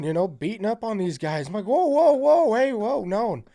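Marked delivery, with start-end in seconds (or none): 1.7-3.3 high, loud string of protests; loud retold plea to back off; mock alarmed protest. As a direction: call it out to halt them, re-enacted. Acted protest when grabbed.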